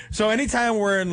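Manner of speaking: ordinary delivery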